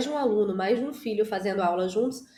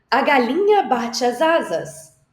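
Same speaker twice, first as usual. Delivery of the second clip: "galinha" louder and higher, slow and showy; dramatized line; 4 semitones higher, 6 dB louder, slower than usual. Shown as a demonstration. Teacher dramatizing the hen for children, loud and slow. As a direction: act out the hen flapping big. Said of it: "ama fazer uma dramatização".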